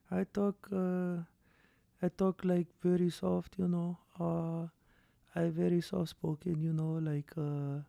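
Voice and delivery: put-on soft-spoken Dagestani accent, hushed at first; quiet, earnest Russian-tinged English of a fighter; Islam impression. Streamer impersonating the fighter Islam for chat, soft Dagestani accent. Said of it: "Islam impression, go".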